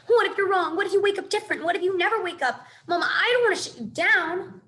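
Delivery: high, loud frightened pleading; panicking child begging his mother; a scared little voice rising in alarm; worried. A narrator's reading. Gosling frightened for his mama.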